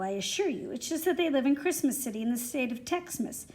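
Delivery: ordinary delivery, nothing marked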